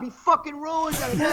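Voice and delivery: high, drawn-out mock complaint; imitated freak-out. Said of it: "He was freaking out".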